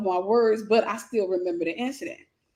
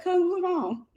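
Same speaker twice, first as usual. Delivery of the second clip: high, soft nonchalant question; calm; 7 semitones higher, 3 dB quieter, slower than usual. Retold or imitated. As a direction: ask it totally unbothered. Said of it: "the nonchalant way".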